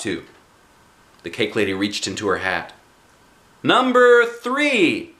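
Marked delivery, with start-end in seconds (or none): 3.6-5.1 drawn-out loud high holler. A narrator's reading. Cake lady hollering the winning number, drawn out. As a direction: call the number out long and loud. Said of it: "she hollered out".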